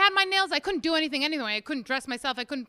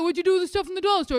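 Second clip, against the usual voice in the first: quick, raised husband imitation; nagging husband voice; 4 semitones higher, much faster than usual. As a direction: fire off the husband's question. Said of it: "for him to ask me".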